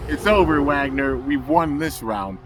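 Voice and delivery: proud mock hero shout; triumphant declaration. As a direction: announce the win to the villain. Winton triumphs over the villain.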